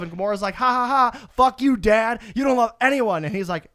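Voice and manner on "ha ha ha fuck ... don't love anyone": raised, punchy mock gloating; spoken fake laugh into a jeer; mock scornful taunt. Gamora throws it back at her dad, mock scornful taunt.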